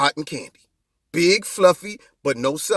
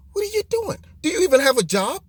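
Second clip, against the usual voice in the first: incredulous question; 8 semitones higher, faster than usual. Retold or imitated. Retorts to imagined protesters.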